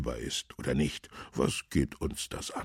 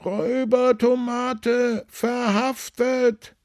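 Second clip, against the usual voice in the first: high, drawn-out street cry; newsboy's shout; 8 dB louder, slower than usual. A narrator's reading. Newsboy hawks papers on the market square, shouting. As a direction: cry the headline across the market, slowly. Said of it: "rief der Zeitungsjunge am Marktplatz so laut er konnte".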